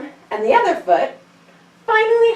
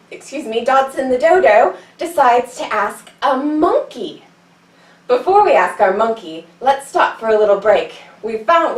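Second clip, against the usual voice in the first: normal talking voice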